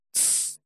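hissed shushing sound; sharp whispered tsss for silence. Acted. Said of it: "цыкнула Алена".

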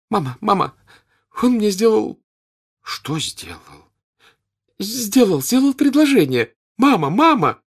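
high, loud, breathless calling; excited cry. A narrator's reading. Natasha to her mother, crying out excitedly. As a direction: burst in bursting with news. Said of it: "кричала она".

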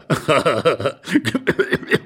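acted bout of laughter; amused laugh. Old man laughing at the intruder, amused. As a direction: laugh along, thoroughly tickled.

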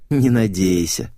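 usual speaking voice

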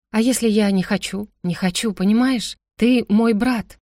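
ordinary delivery, nothing marked

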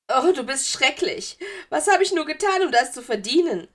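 high, loud, self-pitying wail; wehleidiges Geistermädchen. A narrator's reading. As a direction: lament your suffering as a melodramatic ghost girl. Ghost girl wails self-pityingly. (laut und hoch jammernd)

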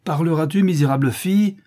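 high, loud furious demand; beside herself. A narrator's reading. Countess shaking her niece by the arm, beside herself. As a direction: lash out, all control lost. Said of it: "hors d'elle-même".

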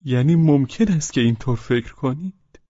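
high, piercingly insistent question; high, penetrating tone. A narrator's reading. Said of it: "با لحنی نافذ گفت".

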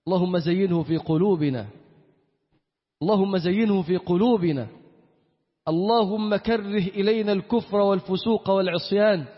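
usual speaking voice

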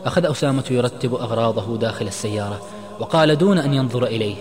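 ordinary delivery

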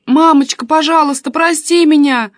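high, loud tearful pleading; crying on the phone. A narrator's reading. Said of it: "рыдала она в трубку".

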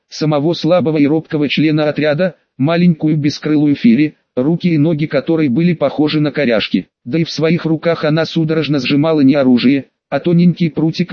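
ordinary delivery, nothing marked

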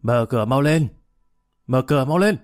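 put-on mild narrated drunken shout; understated yell from the street. A narrator's reading. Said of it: "đang gào lên ngoài đường".

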